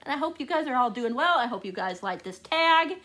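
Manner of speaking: usual speaking voice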